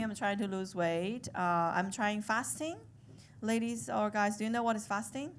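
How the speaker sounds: ordinary delivery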